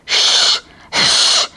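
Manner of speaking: unvoiced "shh" twice; hushing shush. Husband silences his screaming wife, drawn-out shush. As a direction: shush someone with two long hisses.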